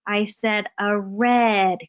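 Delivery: imitating a slow-talking sibling correction; exaggeratedly slow, deliberate correcting. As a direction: spell it out slowly, word by stretched word. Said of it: "be talking slow".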